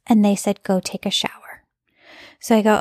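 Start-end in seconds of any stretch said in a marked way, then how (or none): 0.6-1.6 soft, breathy inner voice giving a command; God whispering an instruction; whispered voice of God. Retold or imitated. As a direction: hush it like a voice inside your head. God's voice answering her prayer.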